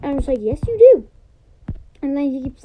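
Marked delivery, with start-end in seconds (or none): 0.4-1.1 louder, higher "yes, you do"; retold insistence. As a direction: insist back at him, raised. Retold argument with friend.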